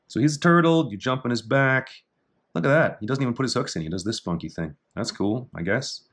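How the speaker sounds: ordinary talk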